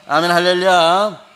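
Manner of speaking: loud, slow 할렐루야 to the congregation; fervent preacher's hallelujah; rousing shout. Real, not acted. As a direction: cry it out to lift the room.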